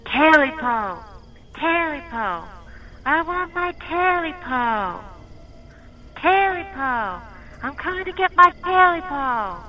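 high, drawn-out eerie creature voice. Acted. Creature coming for its Talipo, high and drawn out. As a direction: wail it, closer and hungrier. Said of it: "only this time a little louder".

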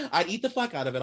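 fast and high through laughter; laughing. Real, not acted. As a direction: blurt it out while laughing. Laughing reply.

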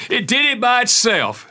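high-pitched imitation of a little boy; young son's excuse. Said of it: "My son was probably six years old".

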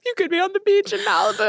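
high, playful taunt; teasing. Real, not acted. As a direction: needle her with a sing-song tease. Teasing friend who misses LA.

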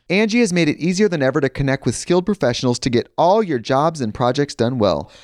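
ordinary talk

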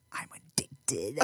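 slow, soft fake confession whisper; mock whisper. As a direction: whisper it like a guilty secret. Confessing tattoo addiction jokingly.

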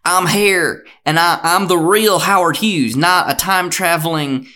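loud, insistent actor playing Howard Hughes; DiCaprio as Howard Hughes. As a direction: overplay a con man insisting he is Howard Hughes. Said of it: "Leonardo DiCaprio coming in, and he's like".

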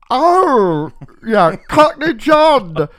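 high, loud, drawn-out cooing; hammed-up admiration, very high and slow; mock gushing. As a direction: imitate a head teacher gushing in delight. Acting out head teacher gushing over boxes.

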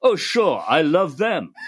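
quoting a French singer in a hint of a French accent; gentle mock French accent. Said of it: "the French accent is much more convincing".

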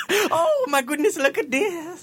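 high, airy fortune teller voice; Romani accent. Pretend. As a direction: gasp over the crystal ball.

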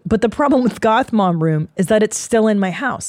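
ordinary talk

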